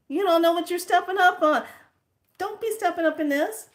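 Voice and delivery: high, loud, fast acted scolding; angry telling-off. Telling the key guy off for cheating, heated. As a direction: let the voice leap up into a quick loud rant.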